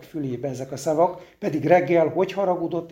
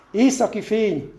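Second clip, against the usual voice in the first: higher, excited exclamation; a raised cry at the sudden light; cry of wonder; 5 semitones higher than usual. A narrator's reading. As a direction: call it out, amazed. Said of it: "kiáltotta".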